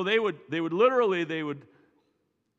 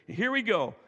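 ordinary talk, nothing marked

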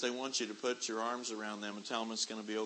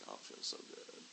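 whispered relief; 14 dB quieter than usual. Retold or imitated. Neck massage relief.

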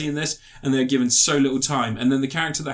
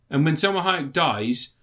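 normal talking voice

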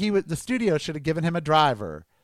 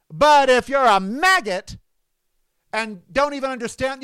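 indignant rant; 7 semitones higher, 5 dB louder than usual. Real, not acted. Ranting insult at the candidate.

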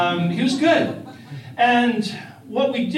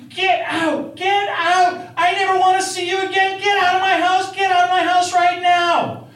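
screaming get out, high and loud; shouting; 12 semitones higher, 6 dB louder than usual. Retold or imitated. Sheila throwing him out, shouting. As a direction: yell her out of the house. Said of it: "I hear her start shouting".

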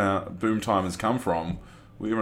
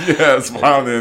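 laughing; 6 semitones higher, 10 dB louder than usual. Real, not acted. Laughing echo.

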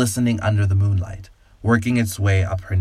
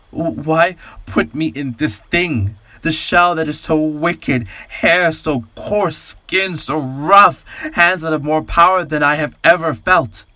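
desperate anguish; 7 semitones higher, slower than usual. A narrator's reading. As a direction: drag out each line in horrified despair. The man despairs at his body.

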